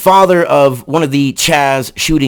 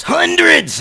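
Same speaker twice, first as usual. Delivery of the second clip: hundreds shouted much higher; incredulous repeated hundreds; shouted emphasis. Real, not acted. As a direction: hit hundreds hard and high.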